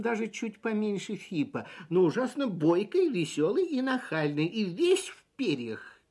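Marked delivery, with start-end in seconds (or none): none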